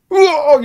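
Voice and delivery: high, loud cry of someone struck; mock scream of pain. Old director knocked over by the punch, hammed-up yelp.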